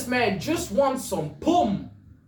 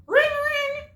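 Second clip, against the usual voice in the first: very high-pitched "ring, ring"; phone ring imitation. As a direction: trill it like a telephone bell. Imitating a ringing telephone.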